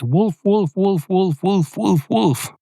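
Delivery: put-on very high kid's voice, frantic calls; child crying wolf. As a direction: squeal it like a panicky little boy. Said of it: "the kid went".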